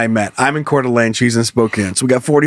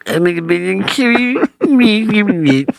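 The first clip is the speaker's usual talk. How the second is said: tinny voice; 5 semitones higher than usual. Retold or imitated. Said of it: "And it's that tinny voice".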